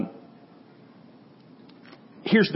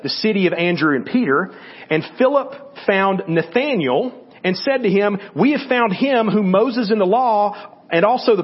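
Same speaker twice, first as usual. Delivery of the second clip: raised, louder reading voice for the Bible passage; scripture reading; 5 semitones higher, 4 dB louder than usual. Acted. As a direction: read it out like a lesson from the pulpit. Reads John chapter one aloud.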